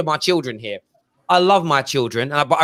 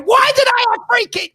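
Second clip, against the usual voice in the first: much higher, louder despairing wail; mock despair at the chaos; overwhelmed dad wailing to himself, much higher; exasperated; 4 dB louder than usual. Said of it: "Now I'm sitting there going".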